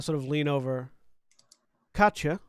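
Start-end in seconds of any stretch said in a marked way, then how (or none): 1.9-2.4 raised, higher call across the lot. Acted. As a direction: call her name loudly. Leans over, calls her name.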